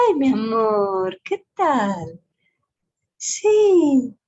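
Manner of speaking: put-on high, slow, sweet talk to a baby. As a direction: coo it to a baby. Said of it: "¿Cómo hablas con un bebé?". (hablando a un bebé, agudo y lento)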